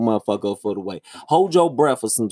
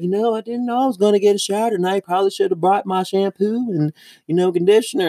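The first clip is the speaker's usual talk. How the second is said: higher, quick, chirpy mock of the coworker; 6 semitones higher than usual. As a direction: parrot her chatter, a bit higher and faster. Mocking coworker's chatty remark.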